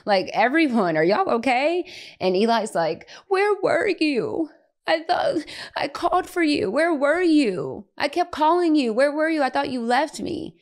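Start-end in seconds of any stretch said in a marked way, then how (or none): 0.1-1.9 higher-pitched startled mom check-in; retold concern. Mom finding her boys in tears, retold concern. 3.3-10.6 put-on high, stretched-out wail of a little boy; upset child, imitated. Tearful son to mom at the door, imitated upset. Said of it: "he has tears in his eyes".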